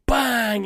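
long, drawn-out bang; imitated crash. Player retelling the ship hitting the wall, imitated crash. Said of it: "you hear us go".

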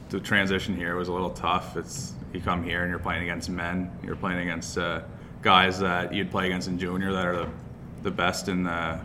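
normal talking voice

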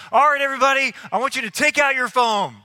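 loud, energetic instruction to everyone; host rallying the crowd. Retold or imitated. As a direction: address a packed room like an event host. Acting host addressing a big crowd.

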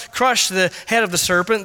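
normal talking voice

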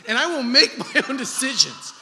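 high, loud mock defiance, half laughing; laughing mock tantrum, high and loud; mock defiant grown-up. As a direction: stamp your foot like a stubborn kid, laughing. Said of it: "This has been my mantra".

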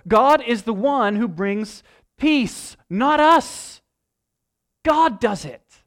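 loud, slow, high pulpit declaration; rising sermon crescendo; full-force preaching. Acted. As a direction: hammer each phrase home from the pulpit. Preaching peak on God ending war.

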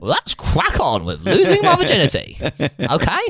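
high-pitched, chirpy comedian impression; James Acaster. Podcast host mimics a comedian getting on with losing virginity, high put-on voice. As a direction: keep up the squeaky put-on voice, briskly matter-of-fact. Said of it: "That's, that's your Acaster".

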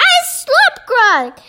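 high-pitched mock squawk; squawking giant. A narrator's reading. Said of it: "squawked the gizzard gulliper".

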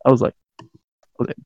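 ordinary delivery

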